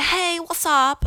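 hammed-up British accent; put-on pommie accent. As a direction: mimic a British backpacker, stretched and high. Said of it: "sorry for that accent".

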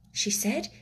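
plain reading voice

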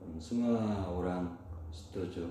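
ordinary delivery

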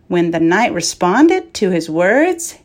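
plain reading voice

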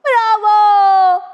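high, very loud, drawn-out bravo; shouted cheer. Acted. Child cheering the dancing dog from the audience, shouting. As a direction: cheer at full voice. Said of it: "diye bağırdım".

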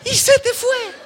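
high, loud, slow dramatic wail; mock lament. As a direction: wail it like the chance is gone forever. Lost chance, mock lament. (lamento exagerado, agudo, fuerte y lento)

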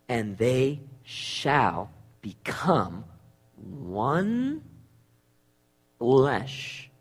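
low, hushed and very slow; solemn scripture reading. Real, not acted. Preacher reads "it says right here" to the congregation, solemn and hushed. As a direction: weigh each word, soft and low.

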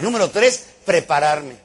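ordinary talk

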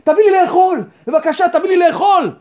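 high, urgent begging call; acted outcry of the hungry; imitated crying out. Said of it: "יש אנשים שצועקים".

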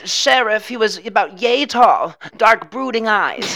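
high, loud put-on witness voice; hammed-up eyewitness report; mock police witness. Plays witness to police sketch artist.

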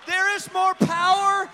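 loud, rising sermon delivery; preaching at full force. Acted.

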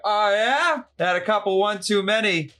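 hammed-up tipsy slasher actor; mock drunk Kane Hodder. Retelling Kane Hodder at the con booth, mock drunk bravado. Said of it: "Kane Hodder was like".

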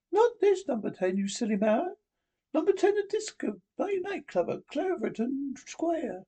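high, prim and imperious; Margaret Thatcher impression. Prime Minister scolding the Doctor, high and prim. Reader voices Miss Thatcher's lines.

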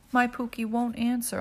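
usual speaking voice

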